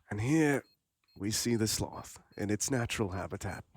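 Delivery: soft, hushed nature narrator; nature documentary. Pretend. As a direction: whisper it like a wildlife film. Mock nature documentary bit.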